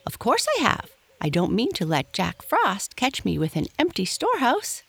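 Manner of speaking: high-pitched, self-assured little chipmunk; chipper chipmunk. A narrator's reading. Striped Chipmunk answering Happy Jack about winter stores, chipper high voice.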